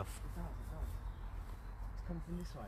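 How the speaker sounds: hushed. Real, not acted. Hushed, tracking the whispering.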